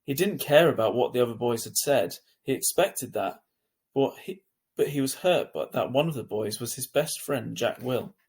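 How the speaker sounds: ordinary delivery, nothing marked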